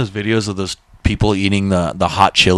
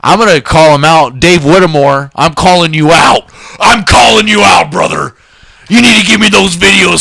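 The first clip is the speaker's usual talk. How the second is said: louder, higher call-out to a friend; mock call-out; 6 semitones higher, 5 dB louder than usual. Real, not acted. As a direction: shout his name out, half-joking. Calling out a friend on air.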